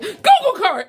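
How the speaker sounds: high, loud excited yell; excited shout. Retold or imitated. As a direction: shout it out as you take off running. Spots the car, runs off.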